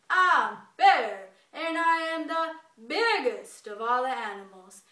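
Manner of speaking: very loud, booming boast; bragging bear. Acted. Bear proclaiming himself loudest of animals, bellowed boast. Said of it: "he starts bragging".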